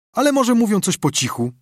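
high-pitched dawning idea; eager suggestion. A narrator's reading. Boy hits on a new idea. (ożywiony, wysoki głos chłopca)

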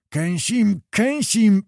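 furious cry squeezed out; enraged Tatar shouts his threat; choked shout. A narrator's reading. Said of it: "krzyknął przyduszonym głosem".

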